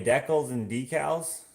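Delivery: "decals" first as "DECK-ulls", then "DEE-cals"; two pronunciations contrasted. Shown as a demonstration. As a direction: set short "decals" against long-e "decals". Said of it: "most people here call 'em decals".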